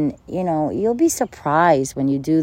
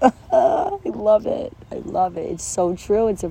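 laughing; 6 semitones higher than usual. Real, not acted. Laughing to her listeners about emotions. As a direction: laugh it out, tickled by the idea. Laughing delight at own point.

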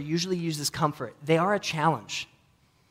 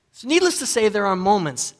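higher, louder punchy lead-in; sudden burst; 6 semitones higher, 7 dB louder than usual. Real, not acted. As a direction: kick off the point loudly. Loud sermon turn.

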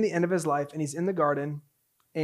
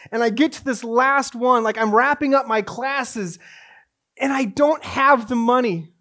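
raised, high-pitched storytelling from the pulpit; impassioned preaching; 6 semitones higher, 9 dB louder than usual. Acted. Preacher recounting his last tuition payment, raised and impassioned. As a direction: drive the story forward with urgency.

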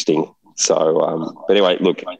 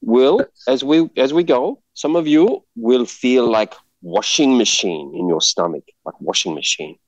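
mock Thai accent; 6 semitones higher than usual. Dive guide warning tourists about seasickness, in a hammed-up Thai-Indian accent. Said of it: "it'll end up Indian".

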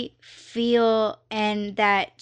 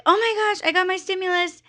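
high-pitched, giddy imitation; excited girl; 9 semitones higher, much faster than usual. Retelling how the woman announced her stimulus, excited. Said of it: "this woman was so happy, she was so excited".

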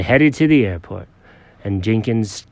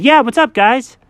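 lightly voiced friend; 12 semitones higher, 5 dB louder than usual. Chuck voiced high.

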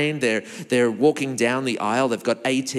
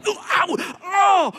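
high ows like a stubbed toe; mock pain yelps; 14 semitones higher, slower than usual. Someone who stubbed a toe, high mock yelps. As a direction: yelp in hammed-up pain. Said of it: "you're like".

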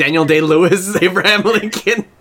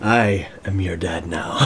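much lower and softer, a deep fake villain rumble; deep menacing villain; 12 semitones lower, 6 dB quieter than usual. Joking with friends as the villain, deep and low. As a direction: claim fatherhood in a deep mock-evil voice. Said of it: "acting out the villain's line".

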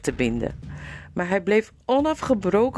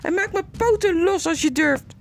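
higher squeaky mouse challenge; defiant mouse; 14 semitones higher than usual. Acted. The bound mouse challenges the slaver, squeaky and defiant. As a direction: dare him, squeaking high.

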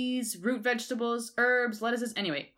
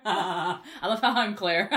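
laughing; 3 semitones higher, 5 dB louder than usual. Real, not acted. Laughs at being called Claire.

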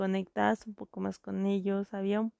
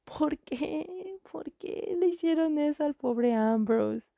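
indignant outburst; 6 semitones higher than usual. Retold or imitated. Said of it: "la terminé de ver y dije".

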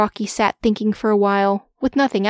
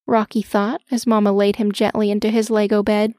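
ordinary delivery, nothing marked

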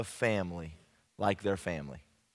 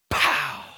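sharp unvoiced pow; whip crack pow; 3 semitones higher, 5 dB louder than usual. Preacher imitating dad's belt striking, sharp unvoiced. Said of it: "belt strike".

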